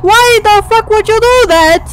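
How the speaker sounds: high, loud, near-crying panic; real freaked-out fear; panicked fear. Real, not acted. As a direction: babble in rising terror. Panicked by unseen noises.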